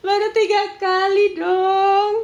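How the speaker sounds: high, loud, slow whiny wail; hammed-up sobbing excuse; mock crying husband. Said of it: "host mocks husband's line".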